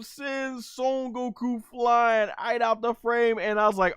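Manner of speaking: ordinary talk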